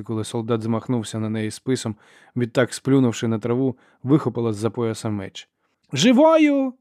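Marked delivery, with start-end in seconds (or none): 5.9-6.7 very high, loud one-word yell; woman shouting. A narrator's reading. Sister orders soldier to capture Ferro, shouting. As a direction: scream the order across a fight. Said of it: "закричала жінка".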